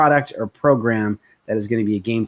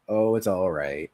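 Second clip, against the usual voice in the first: mild mock down-beat answer; low-energy reply; 5 semitones lower, 6 dB quieter than usual. Shown as a demonstration. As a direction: lower and softer mumble of a glum prospect. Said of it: "they're down here".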